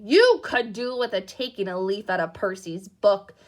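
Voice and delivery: loud scolding yell; mother yelling at her son, loud. Acted. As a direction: yell it while jabbing a finger. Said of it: "yelled Missus Weasley".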